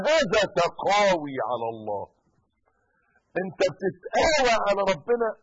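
loud, high scolding shout; stern rebuke. Retold or imitated. Preacher voicing a scholar rebuking the sun-standing man, loud. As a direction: scold the man who defies God.

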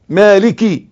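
مالكي with a clear short i after the kaf; correct recitation. Shown as a demonstration. Teacher showing Fatiha reciters the kasra, مالكي ending in clear i. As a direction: end مالكي on an audible kasra. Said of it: "لا، لا، مالكي مكسورة".

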